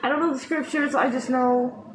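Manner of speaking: ordinary delivery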